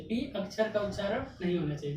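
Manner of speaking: ordinary talk, nothing marked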